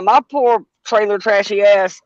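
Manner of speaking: ordinary delivery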